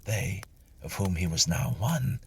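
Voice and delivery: ordinary delivery, nothing marked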